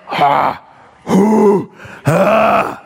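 hawking up phlegm noises, high and loud; mock spitting sound. Shown as a demonstration. Preacher showing the congregation how raka sounds, mock hawking. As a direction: clear the throat like gathering a big spit. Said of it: "I'm really good at that".

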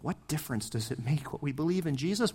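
normal talking voice

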